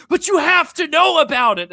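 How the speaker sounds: high, loud mocking whine; whiny mock insistence. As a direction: squeal it like a pushy friend.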